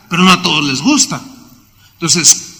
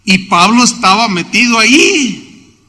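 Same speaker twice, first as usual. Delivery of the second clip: ordinary talk, nothing marked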